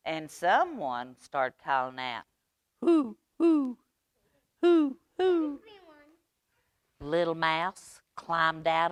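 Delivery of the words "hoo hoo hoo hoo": owl hooting. Acted. Someone calling out in night.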